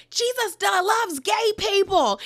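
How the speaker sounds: mock Tamara, stretching it out emphatically; Tamara's retort. Tamara firing back at Jen, high and slow. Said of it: "you hear Tamara say".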